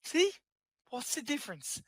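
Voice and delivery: high, lilting leprechaun voice; squeaky little leprechaun. Pretend. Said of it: "Irish, not a leprechaun".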